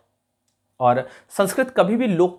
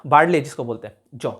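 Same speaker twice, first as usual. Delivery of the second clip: ordinary talk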